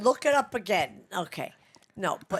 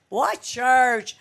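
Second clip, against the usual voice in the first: loud, raised mock question; retold loud heckle; 5 semitones higher, 11 dB louder than usual. Grandma imitating Grandpa interrupting her TV church, loud mocking heckle. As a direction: blurt it out loudly, imitating him.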